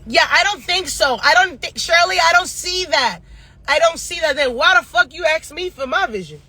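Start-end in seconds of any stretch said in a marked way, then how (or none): 0.1-4.4 higher-pitched mimicking of a client's denial; mocking, raised-pitch contradiction; mock dismissive client. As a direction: imitate someone insistently brushing off what they were told. Mimicking clients rejecting her reading.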